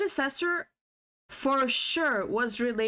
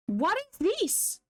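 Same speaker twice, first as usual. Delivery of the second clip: higher, slow puzzled exclamation; surprised; 7 semitones higher, slower than usual. Real, not acted. Looking at this girl's eyes, surprised. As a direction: drag it out in disbelief.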